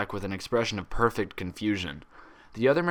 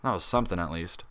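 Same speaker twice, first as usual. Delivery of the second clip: ordinary delivery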